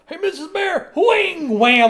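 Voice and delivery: high puppet call then loud crash noises; Rolf crashing. Retold or imitated. Rolf greets Missus Bear and crashes on the ice, high then loud. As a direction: greet her, then bang out the crash sounds.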